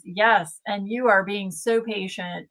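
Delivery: ordinary talk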